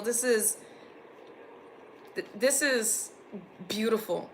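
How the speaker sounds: soft, halting, moved; a little emotional. Real, not acted. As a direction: falter softly, overcome with feeling. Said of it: "I'm getting a little emotional".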